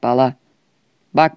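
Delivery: ordinary talk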